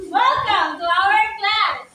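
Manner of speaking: slow, strong, projected welcome; loud voice. Shown as a demonstration. Teacher showing loud voice again to pupils, slow and strong. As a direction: project strongly to a big audience. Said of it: "using loud voice".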